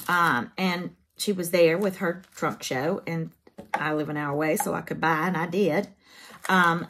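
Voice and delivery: normal talking voice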